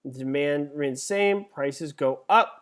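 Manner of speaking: usual speaking voice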